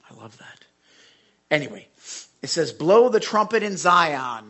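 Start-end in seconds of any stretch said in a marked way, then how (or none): 0.1-0.7 whispered, barely voiced aside. Real, not acted. Preacher's aside. 2.7-4.5 proclaiming. Shown as a demonstration. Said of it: "There's your song".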